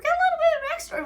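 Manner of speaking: bubbling over with fan excitement; gushing fangirl energy; excited. Real, not acted. Excited about backstory.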